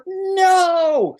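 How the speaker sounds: high, loud hammed-up shout; mock cry of horror. Podcaster mimics characters yelling no as a man falls, high and loud. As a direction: wail as if a friend just fell.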